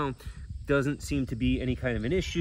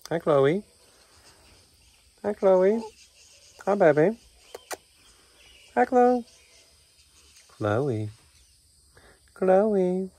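higher and louder sing-song hellos; pet greeting; 8 semitones higher, 9 dB louder, much slower than usual. Real, not acted.

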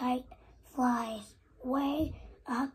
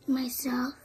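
whispered answer. Real, not acted. Child answers mother in whisper.

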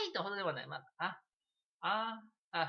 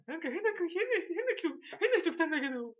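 high, loud, put-on voice, laughing partway; imitating the neighbor; 12 semitones higher, 4 dB louder than usual. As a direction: mimic the neighbor giggling about a weird visitor. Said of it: "めっちゃ聞こえてんの". (変な人が来たと笑いながら話す隣人の高い声)

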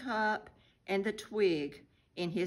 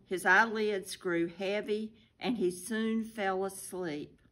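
ordinary delivery

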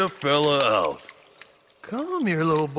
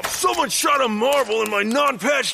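pained yell; 6 semitones higher than usual. Acted. Henchman struck in the eye, indignant yell. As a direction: cry out as the marble hits.

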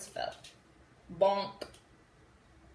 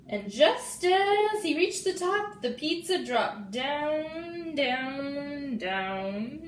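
slow, rising then falling on down down; dramatic fall; 4 semitones higher, 5 dB louder, much slower than usual. A narrator's reading. Reader building suspense as the pizza falls, slow and loud. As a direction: draw out each down as it drops.

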